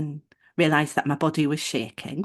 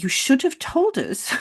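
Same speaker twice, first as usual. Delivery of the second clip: high, slow put-on scolding; mock reproachful receptionist; 8 semitones higher, slower than usual. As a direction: imitate her reproach in a much higher voice. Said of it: "she said something like".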